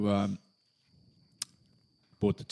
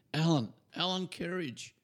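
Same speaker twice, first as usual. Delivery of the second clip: calling out; 9 semitones higher than usual. Retold or imitated. A voice calling his name.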